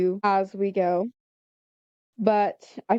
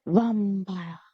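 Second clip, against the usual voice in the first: Transylvanian accent. Shown as a demonstration. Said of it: "I love doing the Transylvanian accent".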